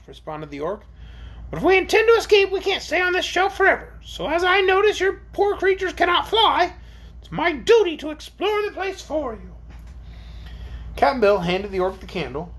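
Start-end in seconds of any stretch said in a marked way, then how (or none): 1.5-9.5 high-pitched creature speech; dutiful orc. Acted. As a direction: explain up high as a resolute orc. Voices the orc.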